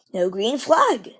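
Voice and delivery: ordinary delivery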